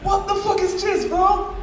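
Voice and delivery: baffled, pitch shoots up; confused outburst. Retold or imitated. Teller to roommate, baffled high-pitched disbelief.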